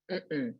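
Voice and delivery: MM-mm, a soft closed-mouth no; "mm-mm" low and falling, weight up front; first-syllable stress. Shown as a demonstration. Teacher models a sacral no, soft MM-mm stressed first. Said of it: "emphasis on the first syllable".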